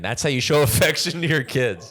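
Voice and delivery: ordinary talk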